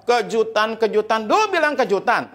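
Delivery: high-pitched scornful rant; angry wife. Acted. Wife mocking husband's promised surprise, angry. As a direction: throw the word back in mock fury. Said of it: "Istrinya marah".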